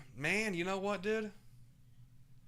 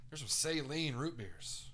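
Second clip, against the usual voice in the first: ordinary talk, nothing marked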